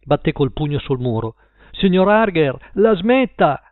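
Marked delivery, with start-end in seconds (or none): 1.7-3.6 higher, louder cry through the wall; shout. A narrator's reading. Paul shouting at Mr Harger through the wall. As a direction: shout to make them stop. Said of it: "gridò".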